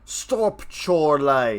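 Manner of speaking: put-on sudden high, loud British-accented yell; mock British outburst. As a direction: imitate Dennis snapping in a posh British accent. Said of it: "And then he goes and he's like".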